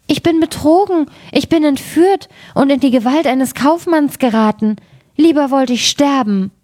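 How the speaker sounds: the princess despairs at being carried off; frightened lament. A narrator's reading. Princess at the rail, frightened lament. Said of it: "rief sie erschrocken".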